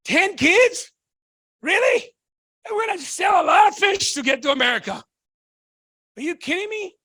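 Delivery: imitating the dad, pitch jumping up in disbelief; retelling his dad's disbelief at moving ten kids to America; mock disbelief. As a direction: play the stunned father hearing the plan. Dad's incredulous reply, retold.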